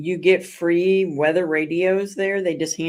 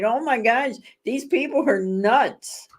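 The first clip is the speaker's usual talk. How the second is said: retold disbelief; 6 semitones higher, slower than usual. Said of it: "I'm like".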